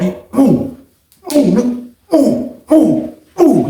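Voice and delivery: high, quick mimicked blows; rapid punch noises. Retelling the fight, mimicking rapid blows. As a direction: fire off the hits fast.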